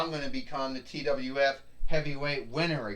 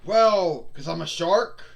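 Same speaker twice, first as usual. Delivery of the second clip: ordinary talk, nothing marked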